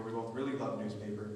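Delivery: normal talking voice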